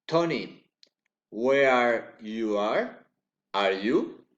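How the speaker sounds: name called, then question rising at the end; rising direct address. Shown as a demonstration. Teacher demonstrating direct address, rising intonation. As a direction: lift the question after "Ella", "Lena", "Tony". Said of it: "raising intonation".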